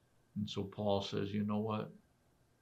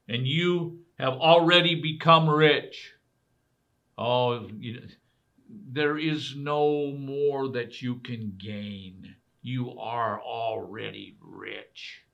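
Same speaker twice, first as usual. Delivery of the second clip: slow, mocking, louder; sarcastic; 2 semitones higher, 7 dB louder, slower than usual. Paul needling the proud Corinthians, sarcastic. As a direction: drag it out with sarcasm. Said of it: "he's saying it very sarcastically".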